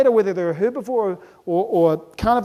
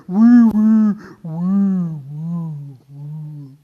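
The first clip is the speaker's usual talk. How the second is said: clogged vacuum whirr; 4 semitones lower, 4 dB louder, much slower than usual. Acted. Speaker imitating a clogged vacuum cleaner, dying whirr. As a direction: let the whirr sag and die away. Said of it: "the filter gets full of dust and dirt".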